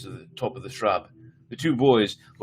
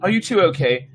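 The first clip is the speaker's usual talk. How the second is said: high, loud demand; breathless demand; 10 semitones higher, 8 dB louder than usual. Acted. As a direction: press her again, out of breath. Mother checks on sons.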